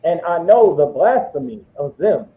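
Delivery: slow, deliberate verse reading; scripture reading. Acted. Resuming the verse for the preacher, slowly. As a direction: drag each word of the verse out.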